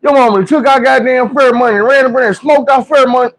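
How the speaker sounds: high-pitched mock-crying complaint; hammed-up tearful outrage; tearful kid accusation. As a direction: wail it like a cheated kid about to cry. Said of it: "She about to cry".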